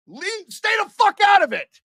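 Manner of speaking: very high, loud yell at the refs; furious shout. Real, not acted. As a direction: scream it at the officials, fed up. Streamer screaming at the officials.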